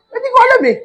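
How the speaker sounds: very high bewildered squeak; comic helplessness. Retold or imitated. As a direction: squeak it out, clueless. Comic helpless cold confusion.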